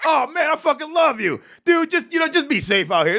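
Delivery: high, gushing fan voice; starstruck cop fan. Retold or imitated. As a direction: gush like a cop meeting his favourite comic. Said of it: "they were like".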